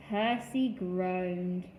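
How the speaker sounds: ordinary delivery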